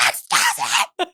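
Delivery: very high, strained mock shriek; squealed interruption. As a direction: squeal it to cut in, exaggerated. Re-enacts her frantic interruption.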